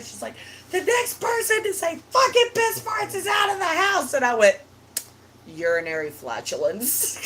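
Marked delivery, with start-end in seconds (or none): 0.7-4.1 high-pitched retold ultimatum; fed-up threat. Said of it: "she got so fucking tired". 5.5-7.2 low, softer mock-formal reply; deadpan comeback. Smart-aleck comeback to stepmom's ban.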